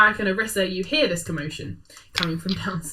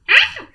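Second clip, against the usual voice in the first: sudden yelp; 8 dB louder than usual. Acted. Creature sound mid-bounce.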